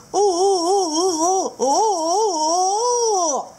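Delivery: high loud muffled oh-oh-oh; tilapia with mouth full. Acted. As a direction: hum through a mouthful of eggs. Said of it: "吴郭鱼就".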